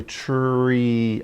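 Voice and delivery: "tree" drawn out slowly. Shown as a demonstration. Teacher showing children how to hear sounds, "tree" said slowly. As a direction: stretch the sounds of "tree" so each can be heard. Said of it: "you could say it slowly".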